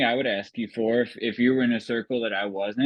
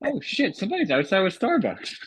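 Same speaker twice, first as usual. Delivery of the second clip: much higher, startled and delighted; startled surprise; 10 semitones higher than usual. Pretend. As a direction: gasp it out, caught off guard. Imagined surprise delivery at home.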